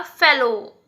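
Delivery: usual speaking voice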